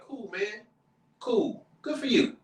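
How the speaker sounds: high, dry unimpressed cool. Retold or imitated. Dad unimpressed by a friend's new car, high and dry. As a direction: shrug off the new car politely. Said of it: "they would bring the new car to and be like".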